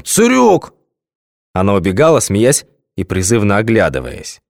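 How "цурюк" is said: high, loud mock German shout "Хальт, партизанен!"; hammed-up yell; silly yell. A narrator's reading. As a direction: bellow like a movie German soldier. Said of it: "орал он дурным голосом".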